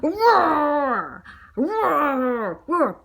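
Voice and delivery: loud roaring lion. A narrator's reading. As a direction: roar like a frightened lion. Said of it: "let out a warning growl".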